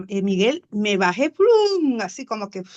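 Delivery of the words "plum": mock thud noise. Jumping out of the car.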